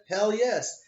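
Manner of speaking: loud, high, all-in yes; an eager loud yes; emphatic hell yes. Retold or imitated. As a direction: fire it out with full enthusiasm. Eager client case, emphatic reply.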